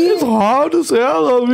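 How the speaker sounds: high, slow, wobbly tearful whine; hammed-up choked-up crying voice; mock fighting back tears. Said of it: "I'm fighting back my tears impression".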